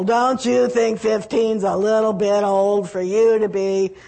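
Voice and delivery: loud indignant group outcry; mock the crowd's loud disapproval; roaring grumble. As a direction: room grumbling at a mother. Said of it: "the roar and the rumble and the grumble".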